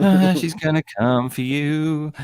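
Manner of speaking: teasing warning. Pretend. Teasing threat about Lani.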